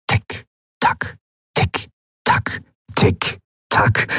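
whispered tick, tack, slow and even. A narrator's reading. Narrator mimicking the ticking clock, whispered. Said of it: "Das Ticken der Standuhr".

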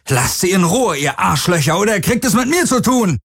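high, fast furious shouting; angry threat. Acted. Galen threatens the captors through the door, furious and high. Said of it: "Und dann wütend".

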